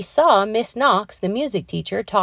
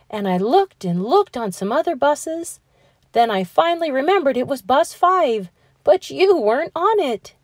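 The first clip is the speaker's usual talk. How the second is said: much higher, breathless happy rush; excited relief; 5 semitones higher than usual. Acted. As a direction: spill it out, relieved to find him. Teacher finally finds Matt, relieved.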